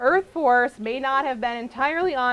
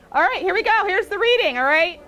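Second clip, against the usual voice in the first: pumped up; 8 semitones higher, 6 dB louder than usual. Real, not acted. Revving up the audience.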